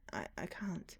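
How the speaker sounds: frightened whisper, breath only; scared whisper. Acted. The scared newcomer whispers to the unseen stranger. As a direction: barely get the words out in fear.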